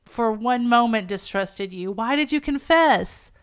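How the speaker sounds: loud, pleading declamation; impassioned heroine. Acted. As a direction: proclaim it fervently, hitting each phrase hard.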